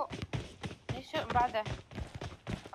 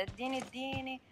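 put-on Saidi Egyptian accent; 2 semitones higher, 8 dB quieter than usual. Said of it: "على قولة المصري الصعايدة".

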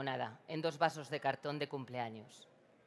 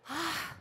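pained gasp; 10 semitones higher than usual. Acted. Sting burning.

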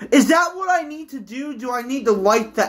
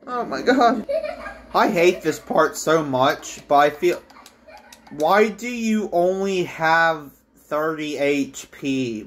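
usual speaking voice

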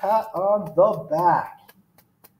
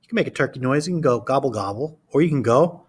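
put-on reading to children. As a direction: read it to a class of small kids. Reads picture book aloud.

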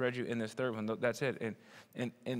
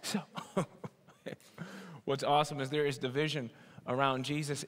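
ordinary talk, nothing marked

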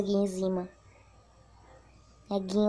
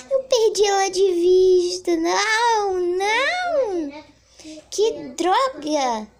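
frustrated cry; 6 semitones higher, 7 dB louder, much slower than usual. Real, not acted. Lost target.

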